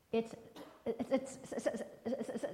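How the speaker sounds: stuck repeating the word, unable to go on; stuttering. Acted. Sam trying to answer the teacher, stuck stuttering. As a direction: catch on the word again and again. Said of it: "stuttered Sam".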